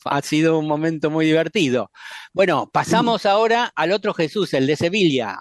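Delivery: ordinary talk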